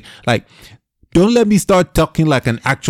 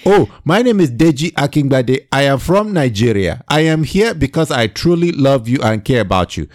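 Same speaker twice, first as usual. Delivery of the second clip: imitating a Nigerian accent; 2 semitones higher than usual. Shown as a demonstration. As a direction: put on a Nigerian accent to show how it sounds. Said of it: "than the guy who sounded like this".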